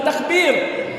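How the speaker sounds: loud high takbir call. Real, not acted. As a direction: call the crowd to answer. Calling crowd to cheer.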